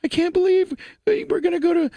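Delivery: high, whiny mock sobbing woman's voice; mock crying fiance. Fiance hearing they're leaving Terre Haute, mock weepy wail. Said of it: "my wife starts crying instantaneously".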